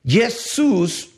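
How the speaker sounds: "Jesús" very slow, broken before "sús"; split into syllables. Shown as a demonstration. Preacher quoting a false etymology, "Jesús" slowly split in two. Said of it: "o Jesús significa he aquí el caballo".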